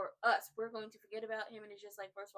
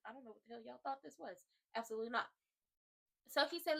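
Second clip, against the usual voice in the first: ordinary talk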